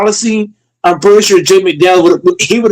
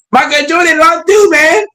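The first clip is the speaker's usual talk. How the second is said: high, exaggerated Greek-African accent; whiny podium impression of Giannis; hammed-up accent, pitched way up; mock Giannis accent; 10 semitones higher than usual. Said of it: "That's a terrible accent".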